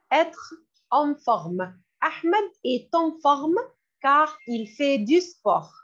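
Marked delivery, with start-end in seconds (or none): 0.1-1.7 slow, drawn-out teacher model; slow model phrase. Shown as a demonstration. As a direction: stretch it out so students can copy. (lentement, bien articulé)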